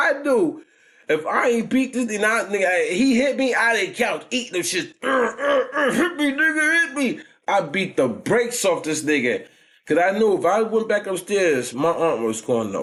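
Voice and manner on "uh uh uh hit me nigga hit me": much higher, whimpering mock sob; mock crying. Mocking the bully whimpering hit me, much higher. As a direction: imitate a beaten kid crying.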